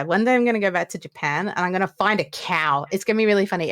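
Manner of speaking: usual speaking voice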